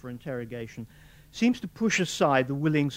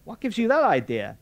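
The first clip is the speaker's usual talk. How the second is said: raised, higher strained hoarseness; hoarse; 9 semitones higher, 8 dB louder than usual. A narrator's reading. Morris answers Fulvia hoarsely, higher and louder. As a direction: croak it out, caught off guard. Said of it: "he said hoarsely".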